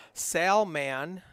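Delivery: Salman said SAL-man, both syllables clear, loud; corrected name pronunciation. Shown as a demonstration. Poker commentator correcting a player's name, careful loud pronunciation. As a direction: punch out Salman with a full "man" at the end. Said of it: "Salmon is pronounced Salman".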